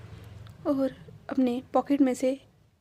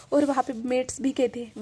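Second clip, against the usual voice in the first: plain reading voice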